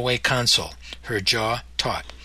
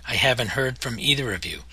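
usual speaking voice

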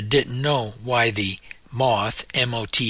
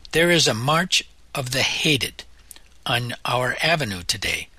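ordinary delivery

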